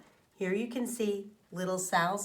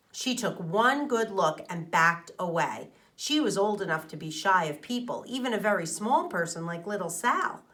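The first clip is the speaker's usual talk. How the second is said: ordinary delivery, nothing marked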